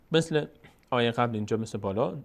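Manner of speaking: normal talking voice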